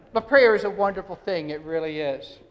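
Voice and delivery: usual speaking voice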